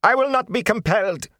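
high, loud, haughty snap; arrogant snap. Acted. Prisoner defying the lord, with an arrogant snap. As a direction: bite it off with pride. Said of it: "an arrogant snap had replaced the whine".

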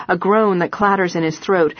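ordinary delivery

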